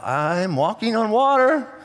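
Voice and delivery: slow, drawn-out amazement; Peter walking on water. Acted. Peter marvels at walking on water, slow and drawn out. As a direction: stretch each word out in wonder. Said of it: "and he's like".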